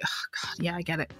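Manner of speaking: breathy aside whisper; whispered eye-roll at the pun. Retold or imitated. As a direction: mutter it under your breath. Groaning at Adam's wink.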